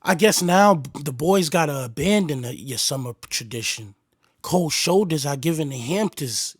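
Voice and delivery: low, soft lyric reading. Acted. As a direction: read the verse low and flat. Reads [name]'s verse aloud.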